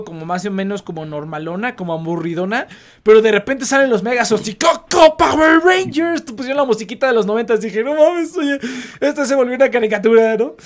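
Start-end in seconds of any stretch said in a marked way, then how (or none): 4.5-6.2 slow, high shouted hero cry. Retold or imitated. Shouting Power Rangers call. 7.8-10.4 surprised. Retold or imitated. Retold own surprise.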